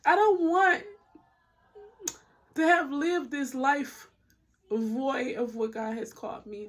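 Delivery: slow, fighting tears; real emotion. Real, not acted. Telling viewers her deepest fear, genuinely emotional and slow. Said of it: "I'm getting emotional".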